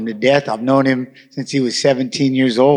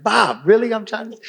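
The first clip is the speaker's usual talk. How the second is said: higher-pitched, incredulous retold complaint; mock protest; 8 semitones higher than usual. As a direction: plead like a roommate woken at night. Woken roommate protesting in retold exchange.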